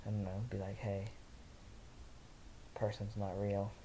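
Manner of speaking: ordinary delivery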